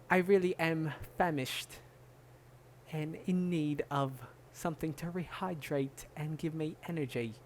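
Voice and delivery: lightly voiced mock British player asking for tea; posh British footballer.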